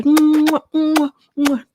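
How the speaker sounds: high, loud "mwah" kissing noises; exaggerated air kisses. Imitating Jaden's kisses on both cheeks, showy mwahs. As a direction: smack out big imitated greeting kisses.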